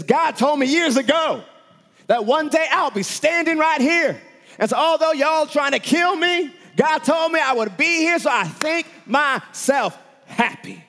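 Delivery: high, full-force preaching shout; soaring shouted declaration, slowing at the end; impassioned Paul. Acted. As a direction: shout a defiant testimony before accusers. Preacher acts Paul answering his accusers.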